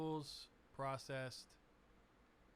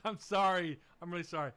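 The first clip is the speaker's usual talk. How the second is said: laughing apology; 4 semitones higher, 6 dB louder, faster than usual. Real, not acted.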